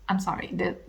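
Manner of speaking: ordinary delivery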